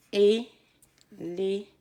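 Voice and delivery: very slow, loud é-lé-phant; slow syllables. Shown as a demonstration. Teacher to kids, counting three beats. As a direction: stretch each of three syllables. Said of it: "Un, deux, trois syllabes".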